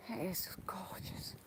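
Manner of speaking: breathy hushed whisper in church. Real, not acted. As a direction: whisper it reverently. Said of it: "be prepared for me whispering".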